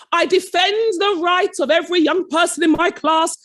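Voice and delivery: lightly voiced pompous colleague; his voice. Head of humanities defending his results, mimicked pompously. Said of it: "He said it like that, in that voice".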